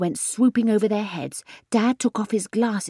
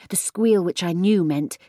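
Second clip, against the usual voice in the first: ordinary delivery, nothing marked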